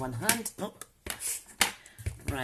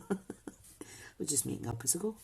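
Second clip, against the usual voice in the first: laughing whisper; 8 dB quieter than usual. Real, not acted. Giggly aside while crafting.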